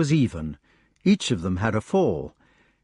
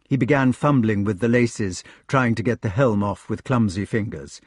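plain reading voice

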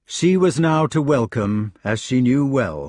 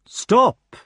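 high, loud sudden call; cried out; 12 semitones higher, 5 dB louder than usual. A narrator's reading. Strange knight halting a long swordfight, crying out. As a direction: cry out to halt the fight. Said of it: "the strange knight cried".

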